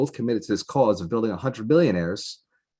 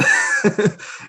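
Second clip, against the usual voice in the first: burst of laughter; 13 semitones higher, 6 dB louder than usual. Real, not acted. Laughs at odd price.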